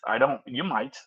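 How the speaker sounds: ordinary talk, nothing marked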